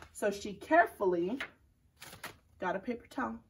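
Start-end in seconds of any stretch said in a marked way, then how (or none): none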